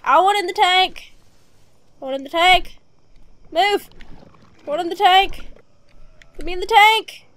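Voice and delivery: loud shouted demand for the tank. Real, not acted. Gamer shouts at the game to let him in the tank.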